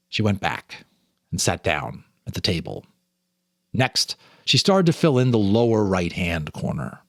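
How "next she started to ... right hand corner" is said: ordinary delivery, nothing marked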